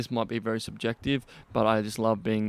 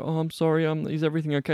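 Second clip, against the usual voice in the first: higher-pitched mock timid voice; timidly apologetic; 5 semitones higher, faster than usual. Mocking men who check in with a woman, timidly apologetic. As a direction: imitate an over-careful, apologetic guy.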